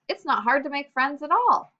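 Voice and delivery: voicing a child who has lost her shyness; newly confident. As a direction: lift it up with relief. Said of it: "let's listen to what she says".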